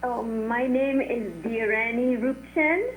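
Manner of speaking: usual speaking voice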